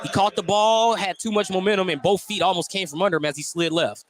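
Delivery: ordinary talk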